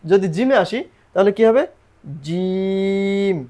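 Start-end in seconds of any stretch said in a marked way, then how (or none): 2.2-3.4 জিম held out very long and even; জিম sung out slowly on a single held pitch; long drawn jim. Shown as a demonstration. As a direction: stretch জিম into one long steady tone. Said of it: "এভাবে।".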